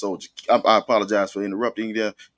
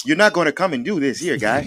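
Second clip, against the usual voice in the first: mock Canadian accent; 6 semitones higher than usual. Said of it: "It was the Canadian accents for me".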